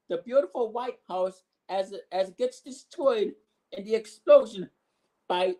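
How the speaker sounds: ordinary talk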